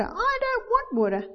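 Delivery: put-on very high childish whine; put-on bratty refusal; refusing child. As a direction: imitate a child protesting, pitched right up. Voices the child refusing water.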